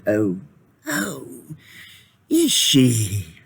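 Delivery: gray terrible voice. Acted. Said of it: "That gray and terrible voice".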